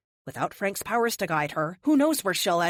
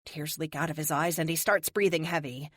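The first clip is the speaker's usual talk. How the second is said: ordinary delivery, nothing marked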